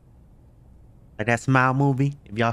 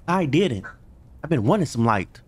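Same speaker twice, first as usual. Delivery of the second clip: usual speaking voice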